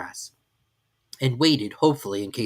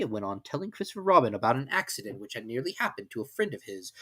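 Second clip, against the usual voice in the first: usual speaking voice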